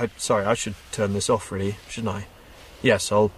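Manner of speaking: usual speaking voice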